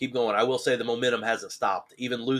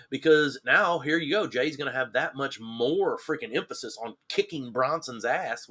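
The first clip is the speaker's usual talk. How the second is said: usual speaking voice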